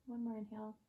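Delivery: ordinary delivery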